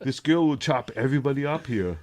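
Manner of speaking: put-on Austrian-accented action-hero line; Arnold impression. Friend continues the Arnold Schwarzenegger bit, Austrian-accented. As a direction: growl it like Arnold. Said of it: "I used to do the Arnold".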